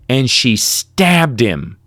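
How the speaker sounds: slow, dropped bombshell; a deadpan shock line; slow reveal. Retold or imitated. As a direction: let each word land slowly.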